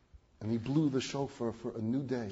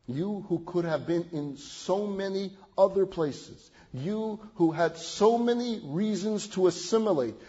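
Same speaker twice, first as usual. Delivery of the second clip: rising fervent appeal; 7 semitones higher, 6 dB louder than usual. Acted. Preacher urging the congregation, building to a loud fervent peak. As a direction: let each "You who" climb in passion. Sermon climax addressing congregation.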